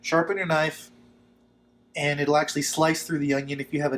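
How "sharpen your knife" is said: normal talking voice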